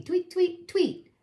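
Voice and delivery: high little chirp; bird tweet. Acted.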